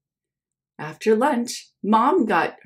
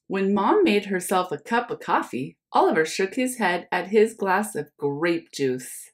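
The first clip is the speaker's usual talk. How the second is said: plain reading voice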